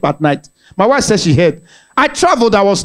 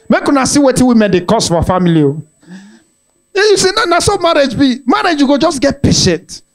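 ordinary talk, nothing marked

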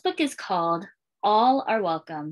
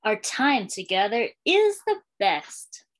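put-on lilting read-aloud for young kids, refrain given room; storytime reading; slower than usual. Librarian reading a welcome book to schoolchildren, storytime lilt. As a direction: read it like a picture book to a class.

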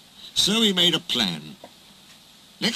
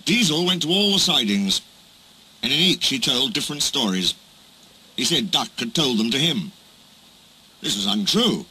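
plain reading voice

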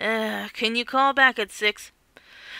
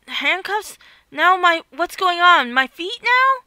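panicked; 6 semitones higher, 5 dB louder than usual. Acted. Hero being trapped, panicking.

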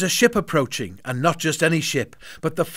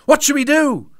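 high, louder panicky question; panicked; 10 semitones higher, 5 dB louder than usual. A narrator's reading. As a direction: ask in a panic. Boy panics.

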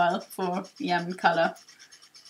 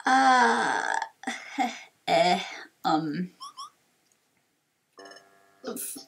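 put-on forgetful old sage, sheepish; slower than usual. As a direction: trail off, then own up sheepishly. Torkoal forgets once more.

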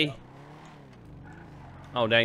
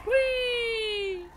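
gleeful whee; 4 dB quieter than usual. Acted. Spinning out for fun.

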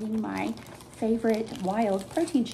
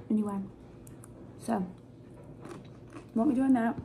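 chewing while talking; talking around a bite; mouth full of chip; 4 dB quieter, faster than usual. Real, not acted. Seen 2: Bites orange chip at 1.8–2.1s, then chews while talking.

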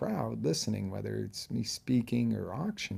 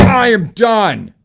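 much higher, loud, slow, total conviction; full-force declaration; 12 semitones higher, 18 dB louder, slower than usual. Shown as a demonstration. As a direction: shout it like you truly mean it. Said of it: "You gotta".

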